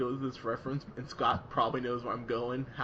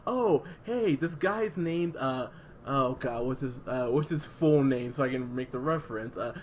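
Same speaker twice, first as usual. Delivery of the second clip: mocking eager writer; 3 dB louder than usual. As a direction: ham up a writer hunting for a reference. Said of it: "It was just like".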